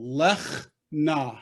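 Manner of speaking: "Lech" ending on a throaty ch, then "na"; teacher sounding out "Lech ... na"; two closed-off syllables. Shown as a demonstration. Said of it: "end this first syllable".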